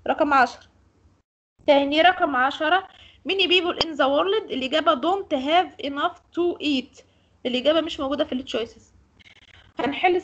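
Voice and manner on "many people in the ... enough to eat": slow, careful reading aloud; slow dictation. Shown as a demonstration. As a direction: read it out slowly for the class. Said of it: "teacher reads the answer slowly". (قراءة بطيئة واضحة)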